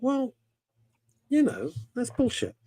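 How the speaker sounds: high, scoffing dismissal; incredulous high-pitched scoff; scornful retort. Retold or imitated. His own thought, retold scornfully.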